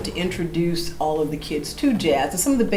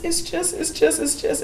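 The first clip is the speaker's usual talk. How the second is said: put-on choked-up girl; 10 semitones higher, 3 dB quieter than usual. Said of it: "She says".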